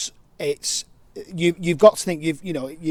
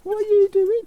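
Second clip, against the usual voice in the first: talking to a dog; 4 dB louder than usual. Real, not acted. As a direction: coo at the dog in a high voice. Greeting the dog in high voice.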